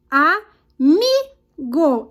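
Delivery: Amigo very slow, MI punched harder; exaggerated syllable split of "Amigo", strong "mi"; stress on MI. Shown as a demonstration. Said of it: "Então, foi o mi".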